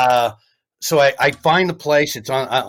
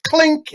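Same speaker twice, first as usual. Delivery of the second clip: mock clink; 14 semitones higher than usual. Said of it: "he's like".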